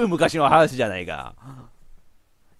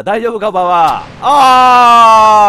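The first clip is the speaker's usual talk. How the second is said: loud, drawn-out mock concern; slow yelled mock concern; slow shout; 4 semitones higher, 11 dB louder, much slower than usual. Real, not acted. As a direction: holler it slowly with mock worry. Mock concern as hag sinks.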